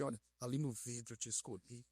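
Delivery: low, soft voice of a pastor speaking for God; tender, hushed pronouncement; pastor's solemn voice. Retold or imitated. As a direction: imitate a gentle prophetic word, dropped low and quiet. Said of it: "com a voz bonita de parecer que Deus tava nele".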